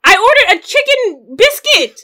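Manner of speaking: high, loud, fast outrage; exasperated complaint at full volume; indignant rant. Real, not acted. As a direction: vent about the frozen nuggets. Ranting about the wrong order.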